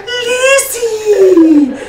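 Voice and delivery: loud, long falsetto squeal; exaggerated high-pitched cooing call. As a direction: imitate a gushing squeal, very high and stretched out. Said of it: "Get this really high-pitched voice".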